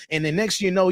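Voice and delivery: ordinary talk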